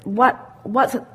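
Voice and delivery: normal talking voice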